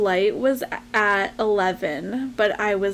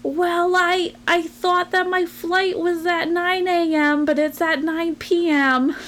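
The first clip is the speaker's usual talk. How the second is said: light imitation of an old Boston lady, higher, slow; mildly imitating a flustered Boston grandma, raised pitch; hint of a Boston accent, higher and slower; 7 semitones higher, slower than usual. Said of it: "she had a really thick Boston accent".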